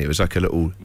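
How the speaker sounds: ordinary talk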